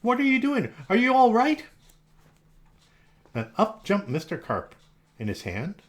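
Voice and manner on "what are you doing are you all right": worried. Acted. Boy worried at pool.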